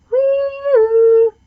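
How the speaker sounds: high, loud siren wail; siren whoop. A narrator's reading. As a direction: sing out like an ambulance siren. Siren sound in truck book.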